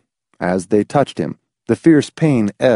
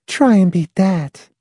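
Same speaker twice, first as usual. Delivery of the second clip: put-on high girl voice; 8 semitones higher, slower than usual. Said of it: "she told McFife".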